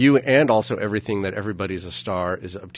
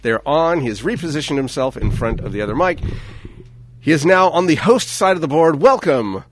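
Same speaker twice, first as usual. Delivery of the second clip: play-by-play commentary; 3 semitones higher, 4 dB louder than usual. Acted. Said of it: "This is very exciting play-by-play".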